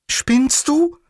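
high-pitched, startled question; indignant Livia. Acted. Livia protests the sudden embrace, high and indignant.

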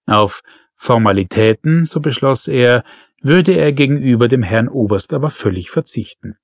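ordinary delivery, nothing marked

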